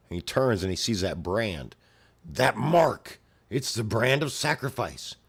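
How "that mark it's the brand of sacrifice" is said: high, loud startled monster voice; alarmed creature. Acted. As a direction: recognition hitting the beast hard. Apostle recognizes the brand.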